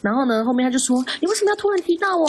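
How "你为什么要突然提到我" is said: higher, mock-angry repeat of the demand; imitating a sudden angry challenge; retold outburst. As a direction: mimic her blowing up at you. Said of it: "他就开始发飙了".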